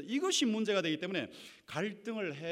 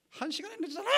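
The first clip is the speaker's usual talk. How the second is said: a higher, lightly voiced wife snapping at her husband; mock angry wife; 12 semitones higher, faster than usual. Preacher voicing a wife scolding her late husband, mock angry. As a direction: voice the wife's accusation, half-acted. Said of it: "wife confronting husband".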